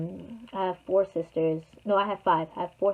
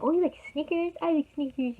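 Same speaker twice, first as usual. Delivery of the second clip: high, sing-song mock of the caller; lightly put-on high voice, faking enthusiasm; imitating a creepy caller; 9 semitones higher than usual. Stranger on the phone wooing a child, high mock voice.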